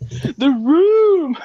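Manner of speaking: high, loud, stretched squeal; excited squeal. Acted. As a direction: squeal the words with glee. Squealing his own line.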